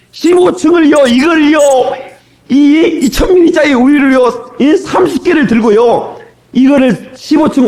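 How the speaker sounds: high, incredulous emphasis on each phrase; exasperated retelling. Retold or imitated. Preacher reliving carrying milk cartons up fifteen floors, high exasperated emphasis. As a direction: pitch it up high, stressing each burden.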